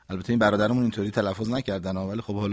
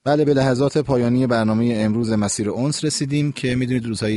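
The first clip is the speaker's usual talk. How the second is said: louder, formal host farewell; radio sign-off; 5 dB louder than usual. Acted. Radio host signs off to listeners, formal. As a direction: close the show warmly for listeners.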